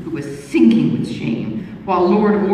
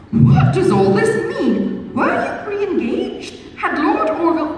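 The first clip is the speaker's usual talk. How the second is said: higher, slow, louder astonished questions; 12 semitones higher, 4 dB louder, slower than usual. A narrator's reading. Missus Mirvan to the young lady, astonished and higher. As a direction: burst out in disbelief. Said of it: "could no longer contain her surprise".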